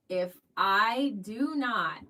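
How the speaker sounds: slow, drawn-out mock dread; mock panic. Wife at slots, slow mock-dramatic self-warning. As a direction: spell out the doom slowly, half joking. Panicked thought while gambling.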